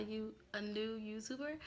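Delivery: ordinary delivery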